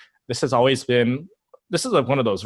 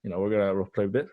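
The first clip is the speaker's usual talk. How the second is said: normal talking voice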